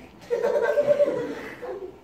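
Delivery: high-pitched burst of laughter. Real, not acted. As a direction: crack up, high. Laughing after the bank joke.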